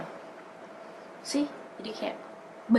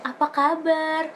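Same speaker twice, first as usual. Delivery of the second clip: foreigner's Indonesian; 7 semitones higher, 3 dB louder, slower than usual. Retold or imitated. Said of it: "terus dia nanya".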